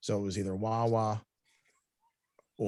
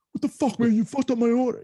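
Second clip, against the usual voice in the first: high, loud mock rant; a hammed-up angry customer; mock angry yell; 13 semitones higher, 6 dB louder than usual. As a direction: show the rude version he didn't say. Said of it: "I wasn't like".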